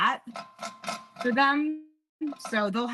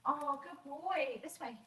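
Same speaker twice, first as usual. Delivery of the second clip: slow, soft, higher praise; praising the dog; 6 semitones higher, 12 dB quieter than usual. Real, not acted. Owner softly praising Danny as he comes, slow and high.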